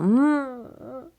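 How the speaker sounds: a light nasal hum; soft hum. Shown as a demonstration. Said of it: "You can't really do an M without it being nasal".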